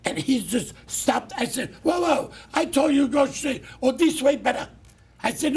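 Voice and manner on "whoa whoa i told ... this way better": mock yell, higher and louder; retold shout. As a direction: shout at the driver to go straight. Retold yelling at the driver.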